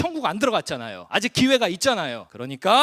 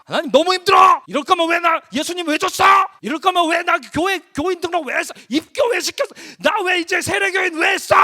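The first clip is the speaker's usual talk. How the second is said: high, loud outburst of protest; acted complaint to God; 9 semitones higher, 8 dB louder than usual. Preacher voicing a believer's complaint to God, shouted protest. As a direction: cry out your grievances, building to a shout.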